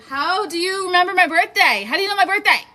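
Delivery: high and loud, thrilled disbelief; excited disbelief. Real, not acted. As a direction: shriek it out, delighted and surprised. Viewers know her birthday.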